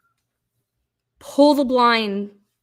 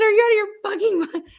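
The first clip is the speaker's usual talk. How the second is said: high, rushed retold outburst; exasperated scolding; 9 semitones higher, much faster than usual. Boss scolds staffer for sending the cook home, exasperated. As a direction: imitate an incredulous boss losing patience.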